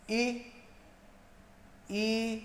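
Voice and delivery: first ई clipped short, second ई held long. Shown as a demonstration. Teacher demonstrating short versus long ई. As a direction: make the second ई last longer. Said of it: "ये अंतर है".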